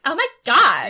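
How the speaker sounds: high, loud valley-girl American accent impression; mock American accent. YouTuber mocking Americans, hammed-up American accent. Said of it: "when I've watched YouTubers do American".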